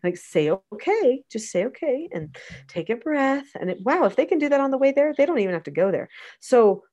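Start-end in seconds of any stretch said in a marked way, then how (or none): 0.5-3.5 coaxing prompt. Acted. Coaching child to calm down.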